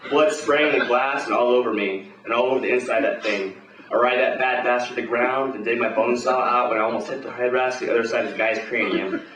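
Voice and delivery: usual speaking voice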